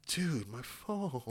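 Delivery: small, slow, soft whine; imitated wounded student; mock dismay. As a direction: mourn it quietly, playing hurt and stunned. Acts out student mourning thrown phone.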